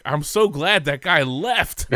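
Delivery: high, slow, gleeful imitation of other fans; relieved fans. Couple glad the jinx walked out, high imitated relief. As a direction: draw it out high, breaking toward laughter. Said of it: "these two people are like".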